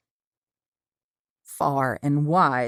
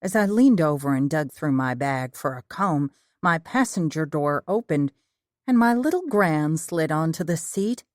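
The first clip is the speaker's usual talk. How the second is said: ordinary delivery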